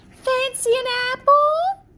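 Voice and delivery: high, loud sing-song peddler voice; a disguised queen tempting her sweetly; put-on crone seller, high and coaxing; old apple seller. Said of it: "dressed up as an old apple seller".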